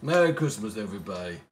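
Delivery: ordinary talk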